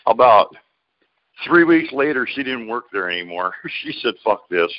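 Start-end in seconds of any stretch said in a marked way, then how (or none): none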